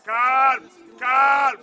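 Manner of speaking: very loud repeated call for calm; full shout. Real, not acted. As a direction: yell it with full force. Coach yelling to players.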